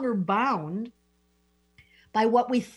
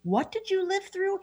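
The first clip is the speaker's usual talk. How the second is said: amazed realization; 8 semitones higher than usual. Retold or imitated. Astrologer voicing a student who suddenly sees the guest's past with amazement. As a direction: voice jumping high in wonder. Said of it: "and you go".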